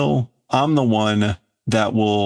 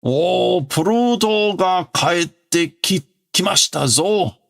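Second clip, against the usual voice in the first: put-on high, slow, excited old voice; a fretful old servant crying out the news; excited old man; 8 semitones higher, slower than usual. New character announces Pluto.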